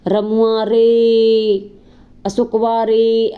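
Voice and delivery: long drawn-out call, loud and far-reaching; village calling out. Retold or imitated. Teacher demonstrates a villager calling someone from afar, drawn-out call. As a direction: stretch each name like calling across the fields. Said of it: "गाँव में जब बुलाते हैं".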